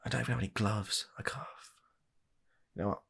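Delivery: ordinary talk, nothing marked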